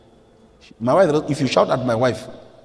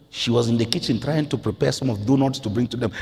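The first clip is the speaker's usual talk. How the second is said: ordinary talk, nothing marked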